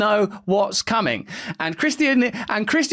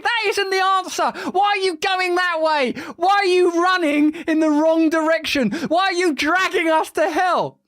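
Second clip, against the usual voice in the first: much higher, pleading rant; exasperated outcry; 6 semitones higher than usual. Real, not acted. Host begging the powers to stop dragging us to hell, much higher. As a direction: demand an answer in disbelief. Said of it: "exasperated rant".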